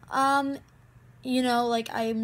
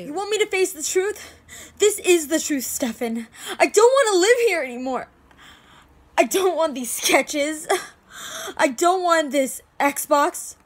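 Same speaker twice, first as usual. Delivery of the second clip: loud, high, distraught protest; fed-up shouting through grief; angry outburst; 5 semitones higher, 8 dB louder than usual. Acted. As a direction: throw each refusal out louder and higher. Acted outburst at Stefan.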